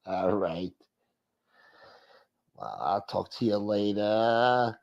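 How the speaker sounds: soft, slow phone-skit voice; put-on creepy caller. Host playing the creepy caller signing off, put-on slow voice. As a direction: sign off quietly and slowly, in character. Low voice in acted phone call.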